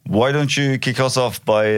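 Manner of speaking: ordinary delivery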